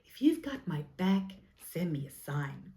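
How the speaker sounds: put-on American movie voice; American accent. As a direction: act it like an American film heroine. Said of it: "doing this in American".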